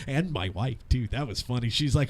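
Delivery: ordinary delivery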